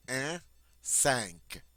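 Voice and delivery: un and cinq stressed on the nasal sound; nasal vowel. Shown as a demonstration. Teacher isolating the nasal sound for students. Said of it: "this has a nasal sound".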